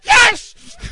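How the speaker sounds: sudden, very high yelled "yes!"; involuntary-sounding outburst. Retold or imitated. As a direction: blurt out a shout in church. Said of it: "spiritual Tourette syndrome".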